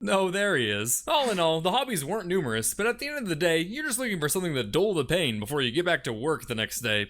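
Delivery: ordinary delivery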